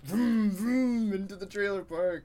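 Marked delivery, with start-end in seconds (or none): 0.0-1.1 engine noise. Pretend. Segment intro, car noise.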